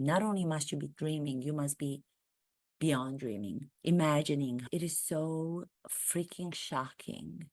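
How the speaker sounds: ordinary talk, nothing marked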